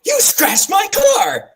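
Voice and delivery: mock shout. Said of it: "and he's like".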